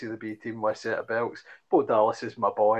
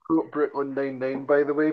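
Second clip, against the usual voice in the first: ordinary talk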